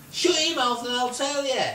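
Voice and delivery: high, loud retort, retold; shouted order. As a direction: yell it at someone out of reach. Shouting back at flying man.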